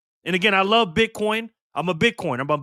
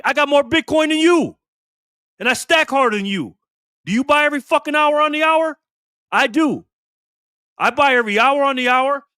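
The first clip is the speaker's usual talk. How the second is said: raised, louder, pitched-up boasting; heated bragging; 5 semitones higher, 4 dB louder than usual. Real, not acted. Ranting at inbox critics who doubt his Bitcoin, heated and loud. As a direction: push it up and outdo them.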